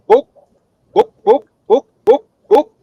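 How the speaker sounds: high, loud clucking chicken; hammed-up chicken squawk. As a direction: cluck out book like a hen. Chicken asks again for book.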